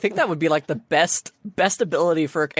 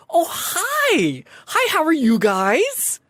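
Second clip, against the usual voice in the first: high, drawn-out, gushing hello; perky sphinx greeter; 13 semitones higher, 3 dB louder, much slower than usual. Acted. Sphinx greeting arriving cosplayers, high and perky. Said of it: "she looks up at you and goes".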